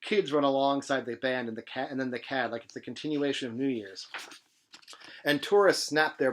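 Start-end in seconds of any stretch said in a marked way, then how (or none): none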